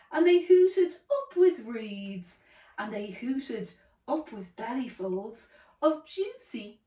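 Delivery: animated storybook reading with big swoops in pitch; children's story narration. A narrator's reading. Storyteller tells how Drip finds the duckbills, animated narration. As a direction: build wonder through each rhyming line.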